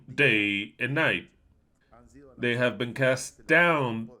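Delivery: usual speaking voice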